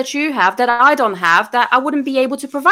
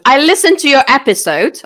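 a louder, brighter pitch opener; louder mock sales greeting; mock spammer opener, louder; 4 semitones higher, 6 dB louder than usual. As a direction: sell the line like a spammer. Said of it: "Started with".

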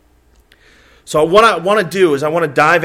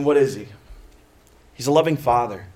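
ordinary talk

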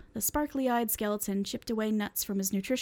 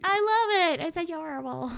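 gushing; 8 semitones higher, 4 dB louder than usual. Real, not acted. Gushing over honey nickname.

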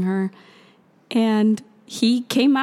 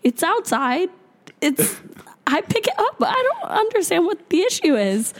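exasperated retort; 8 semitones higher than usual. Retold or imitated. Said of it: "And I was like".

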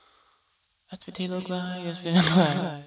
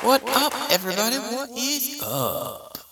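hyped greeting; 10 semitones higher than usual. Real, not acted. Host greeting listeners excitedly.

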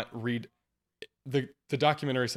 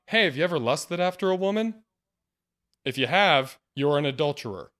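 lightly acted challenge, louder and raised in pitch; acted confrontation; 4 semitones higher, 5 dB louder than usual. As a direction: put it to the crowd, pointed and louder. Acting Jesus confronting the crowd.

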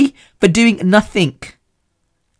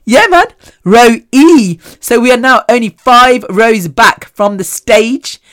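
loud, higher, slowing cheer of joy; excited cheer; 5 semitones higher, 5 dB louder, slower than usual. Real, not acted. Missy cheers to her listeners about closer seats, loud. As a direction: savour the good news out loud. Telling of ticket upgrade news.

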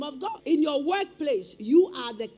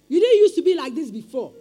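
loud, emphatic; loud exclamation; 3 semitones higher, 11 dB louder than usual. Real, not acted. Preacher telling the congregation they changed, loud. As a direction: call it out to the room.

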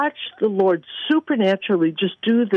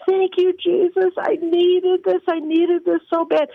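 high, wailing thanks, held out; imitated tearful gratitude; 10 semitones higher than usual. Doctor falls in a puddle of tears, imitated tearful thanks. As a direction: imitate someone overcome, pitched way up and drawn out. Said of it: "she just falls in a puddle of tears".

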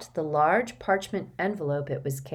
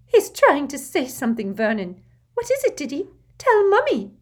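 high coaxing mother voice; anxious Aunt Petunia; 9 semitones higher, 8 dB louder than usual. Acted. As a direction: coax him, high and anxious. Petunia coaxes son.

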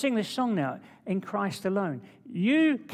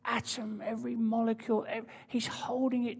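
whisper; 4 semitones higher, 4 dB quieter than usual. Real, not acted. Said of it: "whispered opening of sermon point".

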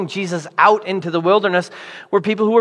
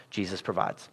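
quiet refrain; 10 semitones lower, 16 dB quieter than usual. Acted. Soft sermon refrain, repeated.

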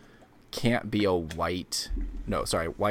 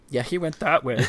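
higher and louder, laughing through it; laughing mock local; 7 semitones higher, 6 dB louder than usual. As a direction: play a helpful bystander, cracking up. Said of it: "everybody's gonna be like".

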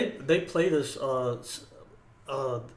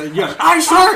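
high, loud mock sounding off; shouted recruit response; 11 semitones higher, 12 dB louder, slower than usual. Recruit answering an insulting sergeant, imitated shout. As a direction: yell the acknowledgement like a recruit. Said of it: "I can't fucking hear you".

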